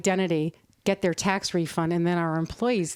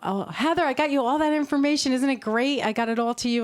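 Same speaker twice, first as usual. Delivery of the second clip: higher-pitched, eager mock yell; imitated calling out; 6 semitones higher than usual. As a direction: call out brightly as an unsuspecting employee, high-pitched. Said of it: "someone yell out".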